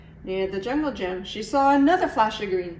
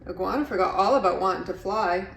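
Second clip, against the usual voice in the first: ordinary delivery, nothing marked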